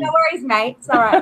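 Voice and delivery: hint of an Australian accent for the crowd. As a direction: toss off a jokey Aussie "No worries, mate". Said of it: "Where's your Australian accent?".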